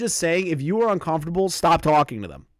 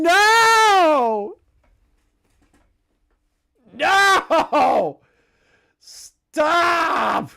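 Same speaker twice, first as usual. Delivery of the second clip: slow build into a high, loud wail; appalled outburst; 12 semitones higher, 6 dB louder, much slower than usual. Real, not acted. Streamer reading creepy messages aloud, appalled wail. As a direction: break off reading and cry out.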